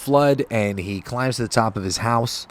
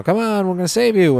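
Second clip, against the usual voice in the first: higher, louder rescuer's call over the water; 9 semitones higher, 5 dB louder than usual. Acted. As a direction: shout down to the man from the helicopter. Helicopter crew calls to flood victim.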